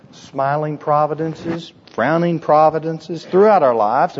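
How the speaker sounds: ordinary delivery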